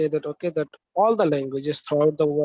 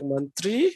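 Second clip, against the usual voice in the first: "mantri" drawn up on its final "tri", as a question; wrongly stressed mantri. Shown as a demonstration. Teacher quizzing students on wrong stress, "mantri" with a rising, stressed ending. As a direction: stretch and lift the end of "mantri". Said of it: "Are we going to say like".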